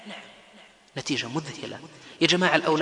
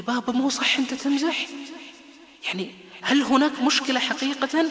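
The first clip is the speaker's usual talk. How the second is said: a hint of a small scared boy, higher; frightened child; 7 semitones higher than usual. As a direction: lightly voice a worried little boy. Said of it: "عمر بخوف شديد".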